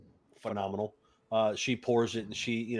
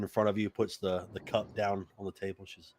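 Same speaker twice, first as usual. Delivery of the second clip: ordinary delivery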